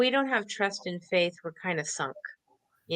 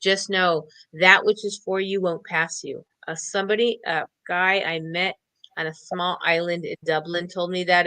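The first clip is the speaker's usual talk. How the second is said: normal talking voice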